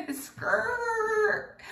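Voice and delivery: high-pitched skrrt like braking tires; screeching-halt sound effect. Pretend. Storyteller halts her own tale with a twist, mock tire screech. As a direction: imitate a sudden screeching stop.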